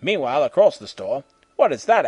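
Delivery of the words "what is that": higher, louder curious question. A narrator's reading. Pippin spotting a hamburger picture, surprised. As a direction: ask it with wide-eyed curiosity. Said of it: "asked Pippin".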